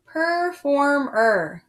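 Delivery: performer stretched slowly, each syllable apart; syllables drawn out. Shown as a demonstration. Teacher clapping out the syllables of performer. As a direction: say per-for-mer slowly. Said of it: "That one would be three syllables".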